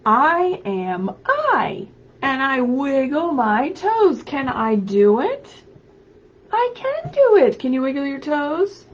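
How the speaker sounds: loud, lively picture-book read-aloud; animated storybook voice calling out to children. Acted. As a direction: read it big for little kids at home. Reads picture book aloud to kids.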